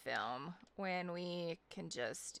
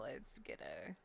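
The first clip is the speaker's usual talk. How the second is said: soft, lower murmured aside; quiet aside; 6 semitones lower, 8 dB quieter than usual. Real, not acted. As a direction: say it under your breath. Muttering to herself while saving.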